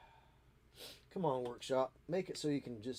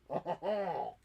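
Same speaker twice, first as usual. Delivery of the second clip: higher laughing lead-in, mock French accent; 5 semitones higher than usual. Joking about own French accent, laughing.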